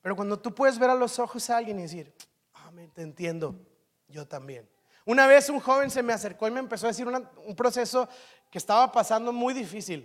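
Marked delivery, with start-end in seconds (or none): 2.5-4.7 much softer, gentle reply; soft empathy. Shown as a demonstration. Pastor showing a heartfelt, soft "me too". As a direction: say it quietly, like you mean it.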